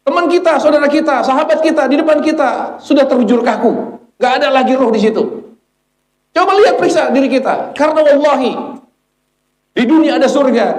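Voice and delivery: very high, insistent sermon cry; impassioned preaching. Real, not acted. Preacher urging the congregation to examine themselves before the dead, impassioned. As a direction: drive each phrase home up high.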